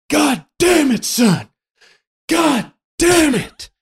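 high-pitched, drawn-out exasperated cursing; exasperated dad. Retold or imitated. Friend's dad finding kids, not burglars, high exasperated cursing. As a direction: imitate a father scared half to death, cursing slowly. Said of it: "I just remember him going like".

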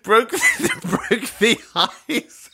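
high, giggling through the words; laughing at own slip. Real, not acted. Host cracks up at own slip, laughing. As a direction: laugh off the blunder, slowing down.

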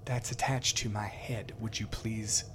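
politely ask, quietly and a little pained; soft npc woman voice. Acted. GM voices long-haired woman.

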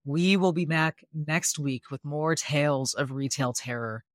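ordinary talk, nothing marked

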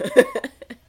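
very high, loud quick laugh; a sharp high laugh; squealing laugh. Real, not acted. Laugh after quoted aside.

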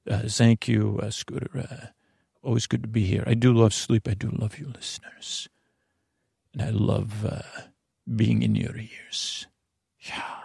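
lightly voiced hushed celebrity; soft Antonio Banderas voice. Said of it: "Thanks, Antonio".

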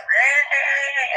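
high, drawn-out pained cat cry. Retold or imitated. Comic imitating a stray cat in pain, high mock cry. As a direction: imitate a hurting cat's yowl. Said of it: "this cat's like".

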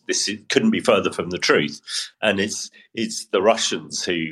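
ordinary talk

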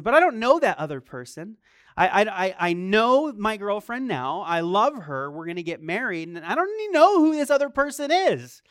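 put-on a young man pleading against God's command; pleading protest.

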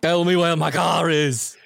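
put-on slow, straining Austrian action-hero accent; Arnold Schwarzenegger accent. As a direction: try too hard at an Arnold accent. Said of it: "I think you're trying too hard".

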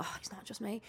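soft, unvoiced relief; whispered relief. Relieved inner thought.

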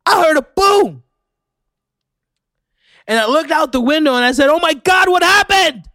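mock the Orlando witness with a squeaky, over-the-top gasp; mocking local eyewitness. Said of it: "that's how they say it there".

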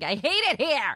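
high whiny outburst; retold snapping. Wife snaps when husband asks what's wrong, high mock tantrum. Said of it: "And I just snapped".

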